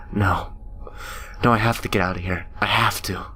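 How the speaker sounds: quick, breathless whisper; desperate whisper. Acted. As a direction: whisper it fast to herself in panic. Trapped alone in the cave.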